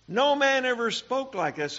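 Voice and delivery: preacher echoing the officers in astonishment; amazed exclamation. Acted. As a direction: ring out the wonder of it.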